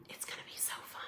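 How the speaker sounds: excited secret whisper. Real, not acted. Shares an excited secret with viewers.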